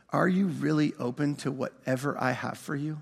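low, hushed, solemn question; quiet, grave inner voice; voice in his head. Retold or imitated. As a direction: ask it softly, like a thought arriving. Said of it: "a voice came into my head".

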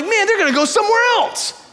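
very high, wailing exaggeration; hammed-up panic, voice way up; mock freak-out. Fretting to wife that kids will leave, mock freak-out. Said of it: "I'm freaking out".

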